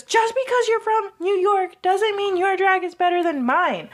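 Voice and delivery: high-pitched mock yell at a rival; half-acted yell, pitched well up; retold yell. Maddie yelling at Jasmine, high mock yell. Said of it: "Maddie is yelling at this point".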